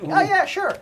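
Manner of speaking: high eager yes. Retold or imitated. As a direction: squeak out an eager sure. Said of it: "And I'm like".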